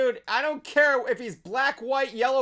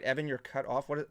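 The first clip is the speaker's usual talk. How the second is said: ordinary delivery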